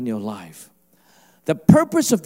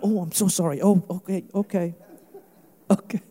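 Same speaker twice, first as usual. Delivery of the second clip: meek, backing-down apology; mock apologetic; 3 dB quieter than usual. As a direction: give in sheepishly when told off. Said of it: "imagine if he was Canadian".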